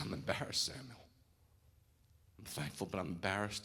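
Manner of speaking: breathy, half-whispered, choking up; retold tearful confession. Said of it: "when I was outside crying".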